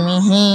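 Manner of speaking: "মিহি" with the final "হি" held long; stretched "হি" at the end of "মিহি"; elongated ending. Shown as a demonstration. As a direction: draw out the last vowel of "মিহি". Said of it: "এভাবে টিন-, টান দিতে হবে".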